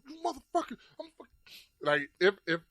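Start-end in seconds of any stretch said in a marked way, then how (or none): 0.1-1.3 much higher enraged voice; mock furious yell. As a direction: snarl it like you lost control. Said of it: "I was like".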